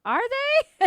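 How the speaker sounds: high, loud mock-surprised wife; wife's shocked high squeal; quoting the wife. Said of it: "his wife is like".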